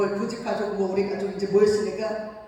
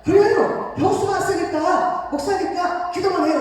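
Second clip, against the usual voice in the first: hearty agreement; 7 semitones higher, 5 dB louder than usual. Retold or imitated. Brother-in-law agrees heartily.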